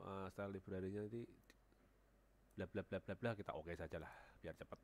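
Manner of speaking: normal talking voice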